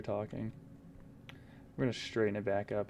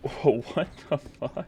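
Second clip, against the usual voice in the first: laughing shock; 4 semitones higher, 5 dB louder than usual. Real, not acted. Laughing at weird noise.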